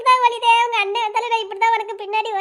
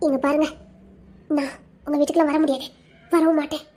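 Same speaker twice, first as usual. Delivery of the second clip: low, firm and serious; 8 semitones lower than usual. Acted. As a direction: drop the joking and mean it. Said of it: "சீரியஸா தான் சொல்றேன்".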